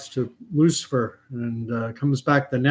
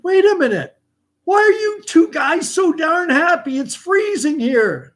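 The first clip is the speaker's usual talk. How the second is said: puzzled devil; 5 dB louder than usual. Acted. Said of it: "And the devil goes".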